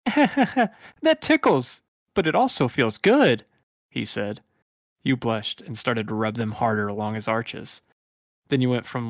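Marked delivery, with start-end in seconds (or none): 0.1-3.5 high, very slow giggly hedgehog voice; Sonic voice. Acted. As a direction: drag it out ticklishly as Sonic. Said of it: "your Sonic voice is just golden".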